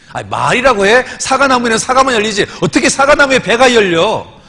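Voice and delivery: loud, high imitation of an indignant deaconess; acted scornful outburst; exasperated retort. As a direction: throw the silly question back, loud and high. Deaconess angrily answers silly question.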